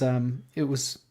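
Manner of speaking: normal talking voice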